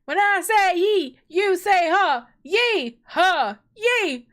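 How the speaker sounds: high, loud hype-up chant; call and response. Pretend. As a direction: lead the crowd in a rowdy yee-haw chant. Leading a yee-haw call and response.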